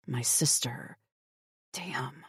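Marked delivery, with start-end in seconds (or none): none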